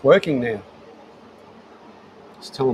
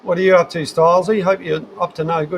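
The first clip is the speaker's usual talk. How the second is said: louder, higher question to a mate; hearty call-out; 6 semitones higher, 5 dB louder than usual. Real, not acted. Streamer checks in on viewer Stylesy, loud call-out. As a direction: hail a mate with a raised voice.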